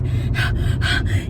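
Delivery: acted panicked gasping; freaked-out gasps. Imitating Brett as he finally caves, panicked gasps. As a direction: gasp for air in mock terror. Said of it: "he's, like, freaking out".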